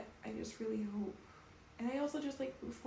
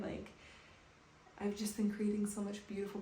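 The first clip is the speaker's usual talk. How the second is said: ordinary talk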